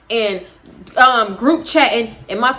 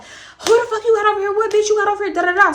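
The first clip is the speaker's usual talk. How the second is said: much higher imitation of her shouting; mock angry yell; 8 semitones higher, faster than usual. Girlfriend shouting at Boney, mock angry yell. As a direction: talk the most shit, pitched high. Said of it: "She was like".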